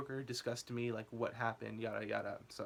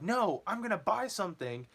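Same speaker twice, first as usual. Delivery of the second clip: higher defiant insistence; stubborn homeless guy; 7 semitones higher, 4 dB louder than usual. Retold or imitated. Told to leave, lightly voiced defiant homeless guy. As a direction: refuse to leave, pushy. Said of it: "the guy was like".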